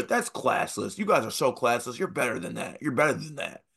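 high-swinging imitation of a whiny scold; mock scolding. Coach lecturing winning players in line, mock scolding.

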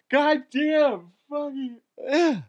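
high, slowed words dragged by a yawn; cursing through a yawn. Real, not acted. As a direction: swear while the yawn takes over. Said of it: "can't stop yawning".

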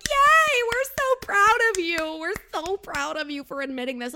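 high, loud sarcastic cheer; mock cheering. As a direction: gush with fake pride. Mocking castmates cheering Mike.

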